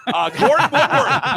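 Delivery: hype announcer. Acted. Announcing guest name.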